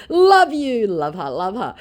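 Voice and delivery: high, loud gush fading off; lightly hammed-up fake celebrity love; mock gushing scammer. Scammer's message read out.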